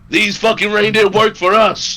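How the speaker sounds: high, loud, drawn-out shout; scream out. Acted. Player shouting out to companions, screamed. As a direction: bellow it to the whole party. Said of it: "I'm gonna scream out".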